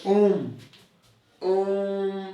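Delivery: ओम held long on the vowel, a stretched pluta vowel; drawn-out om. Shown as a demonstration. Teacher showing students a pluta vowel, long drawn-out om. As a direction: stretch ओम well past a normal vowel. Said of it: "कहने में ज़्यादा समय लग रहा है".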